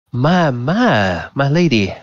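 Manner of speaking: lightly voiced flirtatious gentleman; Volo. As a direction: gallant masquerade guest, lilting. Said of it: "you realize from the voice".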